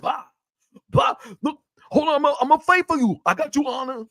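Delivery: high-pitched, hurried mock bravado; accented play-fighting talk; Biggie impression. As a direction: imitate a reality-show star jumping in to defend a friend. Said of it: "Yeah, I'm doing Biggie".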